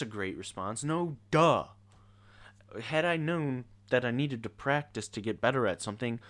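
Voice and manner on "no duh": loud, high, mocking scoff; loud sarcastic duh. Real, not acted. Sarcastic reaction.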